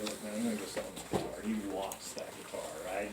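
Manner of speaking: low, soft, hurried aside to the boss; confidential aside. Retold or imitated. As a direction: reenact a quick hushed tip-off.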